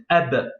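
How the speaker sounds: "Ab" closed on the b with no following vowel; blocked final b. Shown as a demonstration. Teacher giving students the correct reading, "Ab" blocked on b. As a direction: say "Ab" as one syllable, stopping on b. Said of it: "on bloque la lettre".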